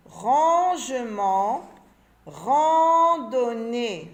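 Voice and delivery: rangement and randonnée drawn out very slowly, syllable by syllable; stretched syllables. Shown as a demonstration. Teacher drags out rangement and randonnée slowly for the children. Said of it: "la syllabe qu'on entend ici".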